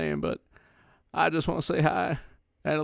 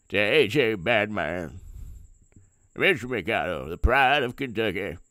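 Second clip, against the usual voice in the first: Mitch McConnell impression; 5 semitones lower, 4 dB louder than usual. Doing his McConnell impression for the hosts, low Kentucky mumble. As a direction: mumble low and heavy like an old Kentucky senator. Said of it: "Uh, Mitch McConnell".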